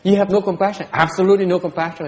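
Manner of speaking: mocking the wife. Teacher quoting his wife again, mock reproachful. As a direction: mimic her scolding tone. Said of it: "She says".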